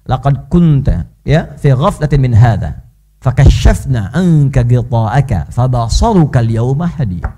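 slow, low chanted recitation of the verse; Quran recitation. Acted. Preacher reciting the verse to the congregation, slow chant. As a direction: intone the Arabic verse slowly and reverently.

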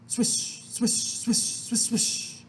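unvoiced-sounding, hushy swishes; swishing noises. A narrator's reading. Reader imitates smoothing the clay round, swishing noises. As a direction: let each swish hiss out.

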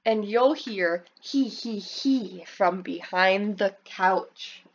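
plain reading voice

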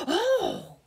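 outraged witch, drawn out; loud gasp, then slow scolding with airy force; witch's angry cry. A narrator's reading. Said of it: "cried the witch".

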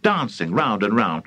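ordinary delivery

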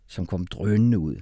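ordinary delivery, nothing marked

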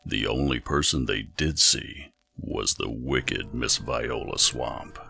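usual speaking voice

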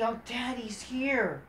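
mock a smitten fan; a lightly voiced smitten fan, slow; swooning mock fan.

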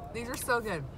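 ordinary talk, nothing marked

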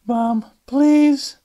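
fed up, begging her to stop; exasperated. Acted. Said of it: "Alice said, exasperated".

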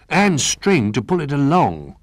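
high, loud, drawn-out suggestion; excited cry. A narrator's reading. Little Bear adds to the go-kart plan, excited cry. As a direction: chime in slowly with high excitement. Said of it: "cried Little Bear".

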